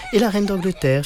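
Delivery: high, insistent schoolgirl questioning; eager little girl. A narrator's reading. Classmate quizzes the supposed English boy, eager. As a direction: pipe up high and keen.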